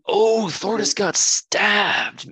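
high, loud, drawn-out "oh" of shock; loud, stretched-out gasp of disbelief; shocked exclamation. Pretend. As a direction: cry out in mock shock at the screen. Calling out shock at film scene.

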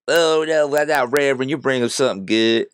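mocking her. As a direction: parody her gushing tone. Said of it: "But she's over here".

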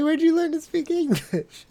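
the interpreter doubting his English; mock incredulous. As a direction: squeak it out in disbelief. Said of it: "she was like".

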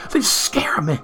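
"They scare me" whispered, small and scared; frightened whisper. Acted. Millicent admits her fear of machines in a whisper. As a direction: breathe it out like a secret fear. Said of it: "frightened confession".

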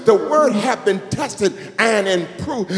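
loud, half-sung preaching; preaching at full force. Real, not acted. Pastor lifting the church about God's Word, loud sung preaching. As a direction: ring out each line like a chant.

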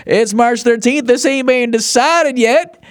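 eager Southern fan's twang, pitched high and slow; Southern football fan. Retold or imitated. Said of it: "I always slip into, like, Southern fan of football".

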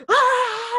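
put-on loud high shriek of a scared child; loud high scream of terror; terrified scream. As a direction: scream in horror. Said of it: "And I started screaming".

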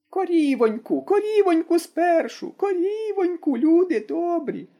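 put-on high, slow wailing old woman's cry; an old granny calling for help, high and drawn out. A narrator's reading. Granny on the roof calls to the people, high and wailing. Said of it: "загукала баба Мокрина".

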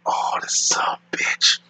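breathy whispered curse under the breath; whispering a silent reaction to the cop's offer; whispered thought. Retold or imitated. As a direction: whisper it like a private aside. Inner thought about the cop.

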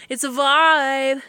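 savoring the phrase; drawn out. Real, not acted. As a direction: stretch each word lazily. Repeats phrase stretched out.

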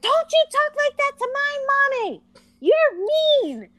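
put-on very high little-girl voice telling off an adult. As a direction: squeal it like an indignant small child. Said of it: "For a little girl to have to be like".

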